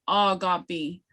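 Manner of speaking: "agape" as AW-gah-pee, first vowel held long; drawn-out syllable. Shown as a demonstration. Teacher giving the word for buffalo, lengthened first syllable. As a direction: hold the long "aw" that opens "agape". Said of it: "which syllable that you draw out".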